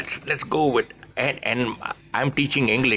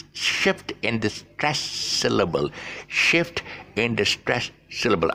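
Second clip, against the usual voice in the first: slow, deliberate teacher delivery; slow and careful, word by word; talking slowly; slower than usual. Real, not acted. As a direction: space out each word for learners. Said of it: "I have to talk slowly".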